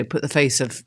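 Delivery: usual speaking voice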